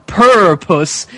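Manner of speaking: ordinary talk, nothing marked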